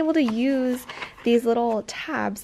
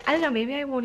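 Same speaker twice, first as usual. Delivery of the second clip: blocked nose; 4 semitones higher, faster than usual. Real, not acted. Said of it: "I'm so stuffed up".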